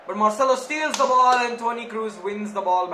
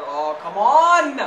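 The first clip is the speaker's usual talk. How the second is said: frustrated; 9 dB louder, slower than usual. Real, not acted. Team concedes a corner.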